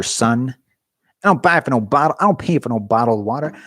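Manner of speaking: ordinary delivery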